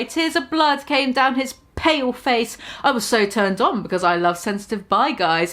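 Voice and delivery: usual speaking voice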